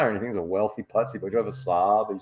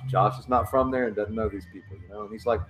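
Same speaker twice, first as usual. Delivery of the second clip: normal talking voice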